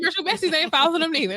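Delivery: mock insistent friend. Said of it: "She like".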